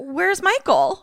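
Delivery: high, slow incredulous question; exasperated comic disbelief; laughing bewilderment. Real, not acted. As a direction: ask it drawn out, close to laughing. Mock bewildered at film lacking Michael.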